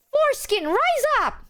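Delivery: high, cheery mock battle cry; imitating mom's rallying cry. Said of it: "she raises her fist like this and went".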